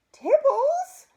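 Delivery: shrill high squeak, very loud. A narrator's reading. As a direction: squeak it in shock. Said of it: "squeaked Missus Featherstone".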